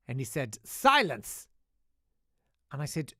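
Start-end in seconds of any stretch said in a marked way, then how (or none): 0.6-1.5 loud, high mock bark of SILENCE; hammed-up shouted command; commanding teacher.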